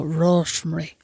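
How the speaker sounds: "Rosemary" softly run together as Irish "Rosh-m'ry"; imitated Irish accent. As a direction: give "Rosemary" a mock Irish pronunciation. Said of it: "learn how to do that accent so he can go".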